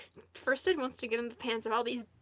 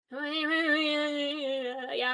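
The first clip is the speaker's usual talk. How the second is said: weird noises; 2 semitones higher than usual. Real, not acted. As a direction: stretch the words into odd idle sounds. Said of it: "I'm gonna make weird noises".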